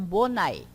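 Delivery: BONAE ending in a short I glide, bo-nai; final J as I. Shown as a demonstration. Teacher shows the plural, BONAE ending in I glide. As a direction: close BONAE on an I sound. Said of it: "a pronúncia dele é de I".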